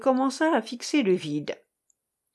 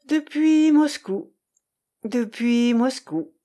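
slow, higher echo with drawn-out depuis; musing repetition; 4 semitones higher, 5 dB louder, slower than usual. A narrator's reading. Irène echoes Grégoire's words after a pause, musing slowly. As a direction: repeat it lingeringly, with a pause between. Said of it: "répéta-t-elle après une pause".

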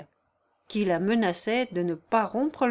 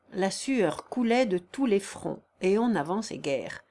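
usual speaking voice

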